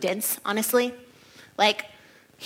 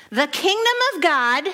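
the kingdom of God said higher and louder, proclaiming; raised voice; 6 semitones higher, 6 dB louder than usual. Shown as a demonstration. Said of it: "I hope he raised his voice, 'cause I am".

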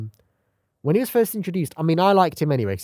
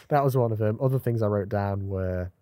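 ordinary talk, nothing marked

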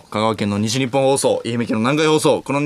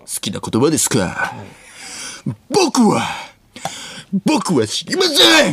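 武田鉄矢; 2 semitones higher than usual. Acted. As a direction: swell into an overwrought teacher's declaration. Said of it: "武田鉄矢みたいにできるやつもある". (武田鉄矢のものまねで、大げさに声を張り上げて)

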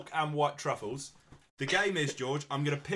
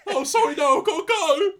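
very high, very loud Scouse yelp; exaggerated Scouse; 14 semitones higher, 11 dB louder than usual. Shown as a demonstration. Actor repeating his praised line, louder exaggerated Scouse. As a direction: shriek the excuse in over-the-top Scouse. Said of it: "Good Scouse".

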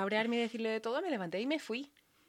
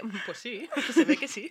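giggling through a guilty admission; a guilty yes, laughing it out; mock sheepish answer with a laugh; laughing sheepish reply; 5 semitones higher, 7 dB louder than usual. Sheepish reply to mum, retold.

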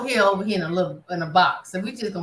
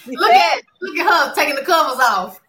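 laughing; 8 semitones higher, 7 dB louder than usual. Real, not acted. Laughing at a friend.

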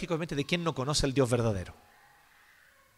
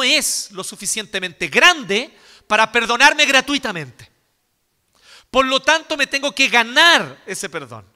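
louder and higher, preaching at full force; fiery preaching; 7 semitones higher, 8 dB louder than usual. Acted. Preacher warning his congregation against false repentance, full-force preaching. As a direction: drive it home like a pulpit climax.

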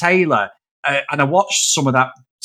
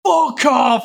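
retold shout; 7 semitones higher than usual. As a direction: snap it at the fans, half-playing. Quoting Bassett swearing at fans.

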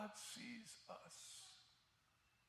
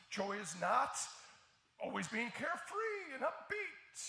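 higher and louder, building insistence; rising pulpit fervor; forceful preaching; 5 semitones higher, 10 dB louder than usual. Real, not acted. Pastor correcting the crowd's idea of joy, forceful preaching.

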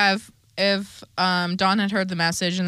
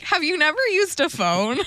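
incredulous retort; 9 semitones higher than usual. Retold or imitated. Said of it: "And I was like".

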